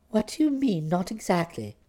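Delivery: low, quiet cross-examining voice; stern fiancée. Acted. Florence sternly questions Bertie's answer.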